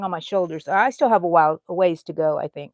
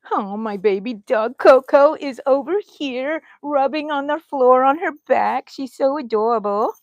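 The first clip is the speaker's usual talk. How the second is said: higher and louder, doting coo; gushing over dog; 5 semitones higher, 5 dB louder than usual. Real, not acted. Telling viewers her dog Coco is rolling around, gushing. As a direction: melt over the cute dog.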